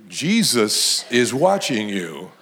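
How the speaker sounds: low, slow, ominous warning; slow and menacingly low; parrot's warning. Acted. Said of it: "and then the parrot added".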